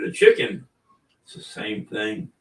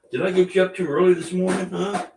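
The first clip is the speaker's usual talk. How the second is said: higher, sing-song voice for the dog; pet talk; 8 semitones higher, 4 dB louder than usual. Real, not acted. Owner teasing his yawning dog Bailey, in high pet talk. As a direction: coo at a sleepy puppy.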